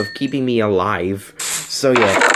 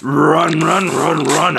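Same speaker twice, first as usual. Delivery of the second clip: funny put-on panicked voice; being chased; 4 semitones higher, much slower than usual. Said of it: "That should be my new voice thing that I do".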